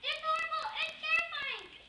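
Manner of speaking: high, loud panicked cries; terrified shouting. Acted. Two elephants shouting to the third, high and terrified. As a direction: yell for help in blind panic.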